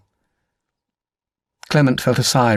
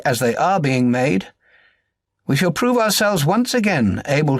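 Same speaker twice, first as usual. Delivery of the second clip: formal speech; 4 semitones higher than usual. Acted. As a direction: declaim it like a wartime speech to Parliament. Churchill speech epigraph read aloud.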